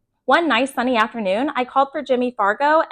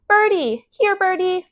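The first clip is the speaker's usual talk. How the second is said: put-on higher, childlike call; toddler calling birds; 7 semitones higher, slower than usual. A narrator's reading. Toddler Fudge calling to pigeons, childlike. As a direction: coax the birds like a little kid. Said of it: "he called".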